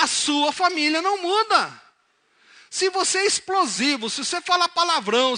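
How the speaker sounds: loud, high, impassioned preacher's exhortation; full-force preaching. Real, not acted. Preacher challenging the congregation to change, loud and forceful. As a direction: drive each condition home at full volume.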